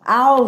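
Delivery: loud, high yelp; pained ow. Acted. Mighty Mac straining underneath, pained yelp.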